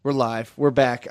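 usual speaking voice

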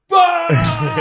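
very high, loud barking "Ah!"; Stalin's startled laugh-shout. Retold or imitated. Stalin reacting to Roosevelt's joke, sudden high shout. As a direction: burst out in a shocked yelp. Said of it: "he goes".

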